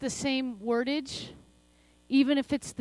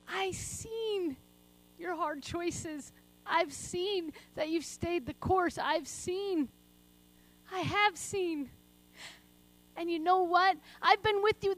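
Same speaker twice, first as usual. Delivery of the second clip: tender Father's voice; 6 semitones higher, slower than usual. Acted. Continues voicing the Father.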